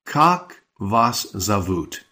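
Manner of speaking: slow, deliberate, each word separated; slow model. Shown as a demonstration. Teacher spelling out the Russian question slowly. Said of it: "She's literally asking".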